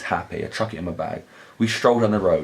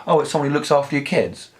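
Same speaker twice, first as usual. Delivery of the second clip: a boy's voice, much higher, lightly imitated; confident kid; 7 semitones higher than usual. Kid explaining Charmander to the narrator, lightly imitated higher voice. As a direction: play the kid sure of a wrong answer.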